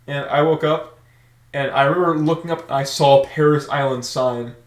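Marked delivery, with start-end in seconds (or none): none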